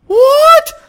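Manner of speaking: very high, loud "what?"; stunned high-pitched yelp; shocked outcry. Acted. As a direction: shriek it in mock disbelief. Acts out viewer's shock at gore.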